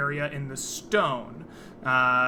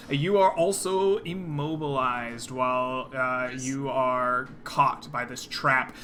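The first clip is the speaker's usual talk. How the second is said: ordinary talk, nothing marked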